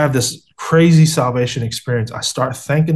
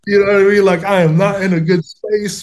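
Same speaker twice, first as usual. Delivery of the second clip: laughing; 6 semitones higher than usual. Real, not acted. Laughing while telling the story.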